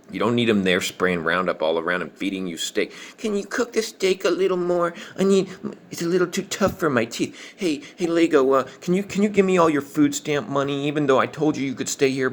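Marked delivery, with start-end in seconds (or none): 3.2-12.3 much higher put-on pleading voice; mocking whiny request. Moocher begging for steak and food stamp money, mock pleading. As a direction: imitate a needy moocher, pitched way up.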